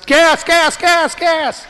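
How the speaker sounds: high, loud voice of a nagging warning light; insistent mock dashboard alert; fuel warning light. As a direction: call it out like an alarm, high and loud. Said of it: "the light goes on and says".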